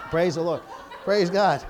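chuckling through praise; laughing. Real, not acted. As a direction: laugh while saying hallelujah. Laughing at his own joke.

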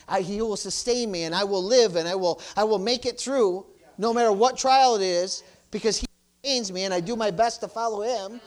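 high, emphatic preacher's delivery; loud bursts of pulpit conviction; full-force preaching. Real, not acted. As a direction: preach it with rising fervor.

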